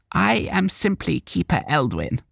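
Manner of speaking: put-on high croaking old woman; old Keeper Eldwin introducing herself, croaking. A narrator's reading. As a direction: croak like an ancient crone. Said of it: "the old woman croaked".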